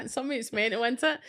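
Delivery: ordinary talk, nothing marked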